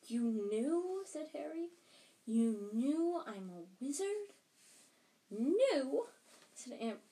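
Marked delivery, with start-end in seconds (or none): 0.1-4.3 louder, higher, rising disbelief; astonished question. A narrator's reading. Harry confronting his aunt about being a wizard, astonished. 5.3-6.1 high, loud, indignant shriek; sudden shriek. A narrator's reading. Aunt Petunia rounding on Harry, shrieking. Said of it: "shrieked Aunt Petunia suddenly".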